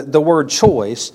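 the word choice drawn out very slowly; slow and deliberate on the word choice; emphasize choice. Shown as a demonstration. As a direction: lean hard on "choice", every word slowed. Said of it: "let me emphasize".

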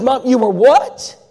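high, loud and slow, stunned; a mother who can't believe her ears; shocked disbelief. Retold or imitated. Mother hearing her daughter was baptized, loud slow disbelief.

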